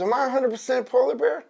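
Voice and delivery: high, quick cub question; baby polar bear. Acted. Cub asking his dad, high and quick. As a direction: ask it fast in a high little voice. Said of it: "He goes".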